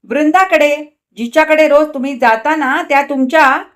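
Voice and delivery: loud, high, biting; sting him with each word; stinging jab. A narrator's reading. Wife taunts husband about Vrunda, loud and stinging. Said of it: "डंख मारीत तिने म्हटले".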